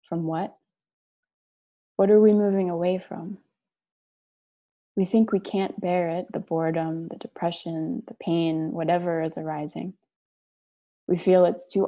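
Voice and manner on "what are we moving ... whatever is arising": ordinary delivery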